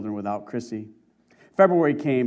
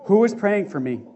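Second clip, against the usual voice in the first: cross-examining; 5 semitones higher, 5 dB louder than usual. Retold or imitated. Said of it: "like that of a cross-examining attorney".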